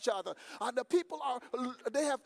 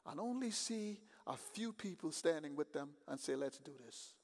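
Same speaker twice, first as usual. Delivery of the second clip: calm quiet command; 3 semitones lower, 6 dB quieter than usual. Acted. Preacher voicing God's calm reply, quiet and low. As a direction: hand down the order calmly and low.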